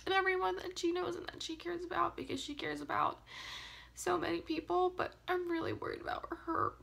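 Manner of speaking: high, tearful voice breaking; crying while talking about loved ones. Real, not acted. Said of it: "Oh, I just can't stop crying".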